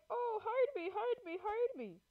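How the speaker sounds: put-on high, pleading girl voice; quoting the girl. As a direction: mimic her begging to be hidden. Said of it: "she was like".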